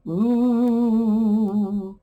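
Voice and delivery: a ghostly drawn out "Ooh"; spooky ghost ooh. Pretend. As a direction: moan "Ooh" like a friendly ghost. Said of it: "look like ghost eyes to you".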